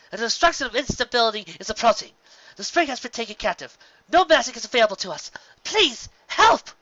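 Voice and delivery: high, loud and rising to a desperate cry; alarmed princess pleading with her reflection; frantic plea. Acted. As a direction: beg the mirror for help, panic growing. Luna pleads at the mirror.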